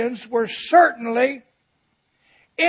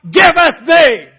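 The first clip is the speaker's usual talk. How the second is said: booming pulpit read of scripture; preaching at full force; 6 dB louder than usual. Acted. Pastor proclaiming scripture to his church, booming. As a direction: proclaim the blessing to the congregation.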